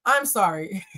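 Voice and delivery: ordinary talk, nothing marked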